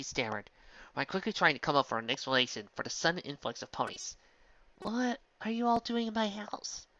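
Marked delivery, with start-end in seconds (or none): 4.8-6.9 confused. Acted. Confused Twilight wakes to crowd.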